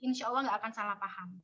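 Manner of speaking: ordinary talk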